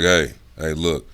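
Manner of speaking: low, serious attention-getter; serious, dropped voice. Shown as a demonstration. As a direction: drop your voice and get serious. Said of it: "I drop my voice".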